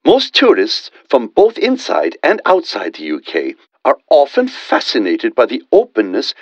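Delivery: usual speaking voice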